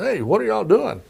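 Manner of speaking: casually calling into the room, mock Southern ease; imitating Clinton. Reporter retelling Clinton popping his head in, light impression. President Clinton voiced in retold story.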